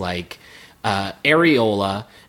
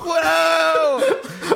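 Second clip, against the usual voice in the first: high, drawn-out comic wail; long high-pitched joke shriek; mock scream. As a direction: howl it in mock alarm. Joins in the screaming bit.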